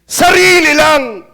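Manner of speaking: slow, loud stress on sarili; preacher pounding Sarili lang; emphatic repeat. Real, not acted. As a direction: drag out Sarili lang, hitting sarili hard. Preacher hammers the point.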